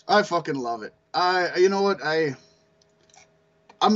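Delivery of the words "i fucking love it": usual speaking voice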